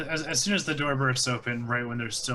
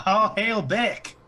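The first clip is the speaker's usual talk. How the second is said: high, loud, slow hail; drawn-out, high cry of homage; acclaiming a king; 8 semitones higher, 6 dB louder, much slower than usual. Acted. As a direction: proclaim it to the whole hall. York hails the new king.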